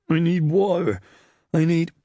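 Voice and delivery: high-pitched pleading whine; whining. A narrator's reading. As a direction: moan pitifully until cut off. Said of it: "Serlo whined".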